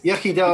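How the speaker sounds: Welsh pronunciation of Yachida; Welsh toast. Shown as a demonstration. Pub host toasting his guest, Yachida in Welsh. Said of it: "as we say in Wales".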